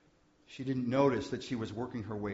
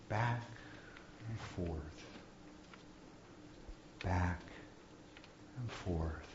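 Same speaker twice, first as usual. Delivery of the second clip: soft rocking; 4 semitones lower, 11 dB quieter, slower than usual. Acted. Gently rocking a sleeping child.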